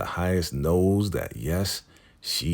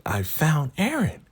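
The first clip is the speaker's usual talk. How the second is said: high Joan voice; 11 semitones higher than usual. Retold or imitated. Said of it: "she's like".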